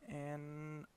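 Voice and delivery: ordinary talk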